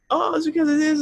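exaggerated high daughter voice; imitated upset daughter. Voices crying daughter's excuse.

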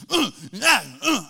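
loud imitated kid grunts; frustrated grunts. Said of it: "he starts doing this grunt thing".

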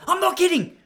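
high, loud yelp breaking into a laugh; mock the postman's frantic insistence; alarmed postman insisting. Postman insisting to the narrator that he means it, in a high yelp with a laugh. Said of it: "He goes".

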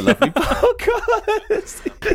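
high-pitched, slowed by real laughter; laughing. Real, not acted. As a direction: break up laughing mid-sentence. Cracks up at his own wording.

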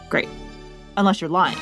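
normal talking voice

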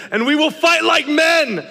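rally the troops, hammed-up; mock heroic battle cry.

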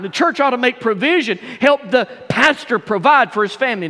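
indignant protest. Acted. Apostle asking why Peter gets paid, indignant protest. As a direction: protest the unfairness to the congregation. Voices Paul protesting unequal support.

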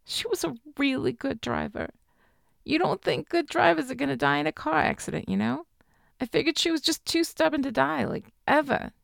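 high, soft grieving student; tearful student. A narrator's reading. Weeping student voiced by narrator.

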